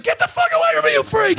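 high-pitched mock freak-out; mock outburst. Comic recoiling from the woman's touch, high-pitched freak-out. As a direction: blurt it way up high, repelled. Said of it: "trying not to be like".

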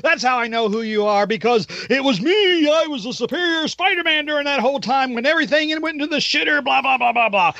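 mock Doc Ock gloat. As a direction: crow like a smug supervillain, lightly hammed. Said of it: "And Doc is going".

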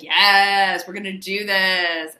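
loud, insistent command; pushy squip voice. Retold or imitated. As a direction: bark it like a bossy AI. The squip pushes Jeremy on.

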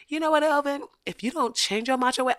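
high, quickening mock telling-off; Claire Huxtable. Mother warning Elvin about his macho way, mock indignant. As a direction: imitate a mother reading him, pitched high. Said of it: "the way she gathered Elvin".

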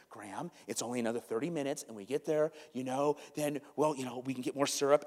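put-on soft, calm reasoning with a child; quietly talking sense to a melting-down four-year-old; sensible soothing. Dad to crying son in the van, soft and reasoning. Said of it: "I begin to try to talk sensibly to him".

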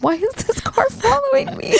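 high-pitched worried squeal, played for laughs; mock alarmed mother. Mother in the car with her kids being tailed, mock alarm. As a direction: imitate a panicking mom in a high voice.

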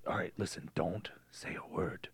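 urgent whisper. Retold or imitated. Father whispering orders to his daughter on the phone. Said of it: "he's like".

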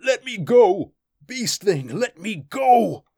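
high, loud panicked screaming; terrified cries. Acted. Thord screams at the beast gripping his wrist, terrified. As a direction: beg and shriek to be freed. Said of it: "did not hear his cries".

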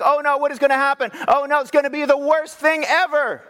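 high, panicky "oh no"; mock panic. Preacher mocking the congregation's election panic, high and fretful. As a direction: whine it like a worried voter. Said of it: "we're just like".

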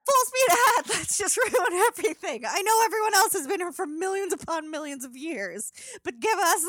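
high, loud, laughing cheer; gleeful reckless humans. Retold or imitated. Voicing Homo sapiens ruining the planet, loud gleeful cheer. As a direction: shout it like charging off a cliff.

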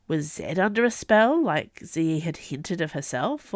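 plain reading voice

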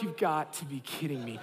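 low defeated mutter; muttered disbelief. Retold or imitated. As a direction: say it under your breath. Standing in line, muttering.

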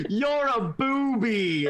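hammed-up insult, slow and high; drawn-out quote. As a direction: stretch it out for comic relish. Quotes favourite line from the book.

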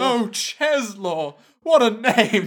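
high, loud, drawn-out coo; hammed-up doting. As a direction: gush over the name, exaggerated. Joins in the acted group gushing.

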